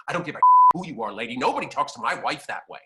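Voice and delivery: loud, fast mock outburst; an acted husband defending his wife, loud and fast; protective father. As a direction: rush the words out as an imitated angry father. Protective father confronts Betty Davis.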